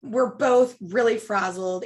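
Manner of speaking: usual speaking voice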